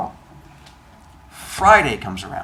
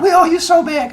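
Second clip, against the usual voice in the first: very high gushing promise; gushing friends; 7 dB louder than usual. Retold or imitated. Friends swearing gratitude, squealed very high. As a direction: squeal it up high like eager kids.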